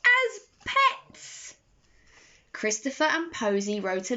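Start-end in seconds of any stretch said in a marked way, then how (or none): 0.1-1.5 much higher, eager exclamation; a delighted reveal, pitched way up; bright idea. A narrator's reading. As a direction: burst out with the happy solution. Posy's marvellous idea revealed.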